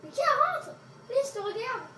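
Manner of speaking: high loud nervous pleading; scared horror game streamer. Real, not acted. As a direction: push someone else in first. Scared, urging other to enter.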